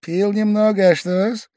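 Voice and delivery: high, innocent little answer; evasive shrug. A narrator's reading. Pavel Pavlovich dodging the question, high and innocent. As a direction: play innocent.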